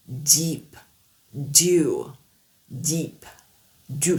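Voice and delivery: deep, dew, jaw dropped a little; deep et dew, mâchoire abaissée; English D demo. Shown as a demonstration. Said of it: "La mâchoire est légèrement abaissée".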